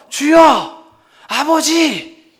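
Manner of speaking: crying out to God. Acted. Believer before the Lord calling out, loud slow cry. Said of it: "이렇게 부르짖기만 해도".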